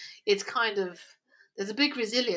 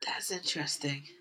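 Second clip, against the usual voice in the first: whispered aside, lower; 6 semitones lower, 5 dB quieter than usual. Real, not acted. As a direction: breathe it out almost silently. Reacts in a whisper.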